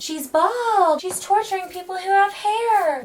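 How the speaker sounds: high-pitched exclaiming voice; playfully scandalised, pitched right up; mock outrage. Exclaims over the bald figure, repeating.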